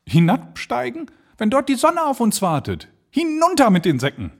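viel höher, entrückt begeistert; manic stranger. Acted. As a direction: ruft es schwärmerisch heraus. Stranger refuses to descend. (der Unbekannte zum Erzähler, fanatisch begeistert)

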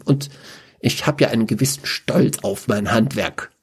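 a vain sorcerer explaining his blood art; a lightly voiced, pedantic dark mage.

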